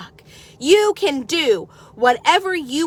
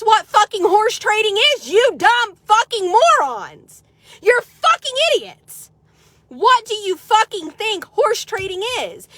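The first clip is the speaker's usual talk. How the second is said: angry insults; 7 semitones higher than usual. Real, not acted. Scolding Facebook critics who don't get horse trading, furious. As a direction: spit the insults at them.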